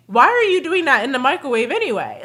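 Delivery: ordinary talk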